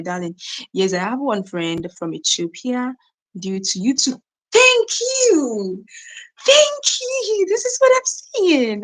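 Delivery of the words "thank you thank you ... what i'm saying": very high, squealed thank-you; high-pitched gleeful gratitude; delighted thanks. Real, not acted.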